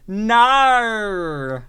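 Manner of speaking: loud, high, drawn-out Aussie no; mock Australian no. Heckler echoing every no she says, hammed-up Australian accent. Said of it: "just say".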